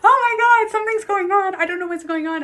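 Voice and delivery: high frantic cry, easing off toward the end; screaming in panic. Retold or imitated. Screaming to the boyfriend, re-enacted panic. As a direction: re-enact a panicked call for help. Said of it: "screaming at my boyfriend".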